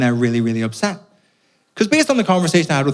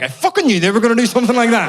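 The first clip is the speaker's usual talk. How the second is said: high, louder exasperated outburst; furious I-knew-it; 11 semitones higher, 4 dB louder than usual. Retold or imitated. Irish audience member fuming at the English officer, exasperated. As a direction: spit it out, fed up and vindicated. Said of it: "we're like".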